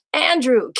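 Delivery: loud, high, fast mock scolding of a sleepy student; playful exasperated shout; exasperated teacher call. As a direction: call him out of bed, half laughing.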